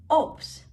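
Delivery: "Obst" with a P sound for the B; teacher's demo of "Obst", the B hardened to P; B pronounced as P. Shown as a demonstration. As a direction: model "Obst" as "opst". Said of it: "the B in the word is pronounced as".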